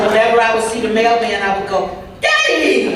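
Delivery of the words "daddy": put-on high, eager child's cry of daddy; excited call. Little girl greeting the mailman, high excited call. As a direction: call out like a little girl spotting her dad.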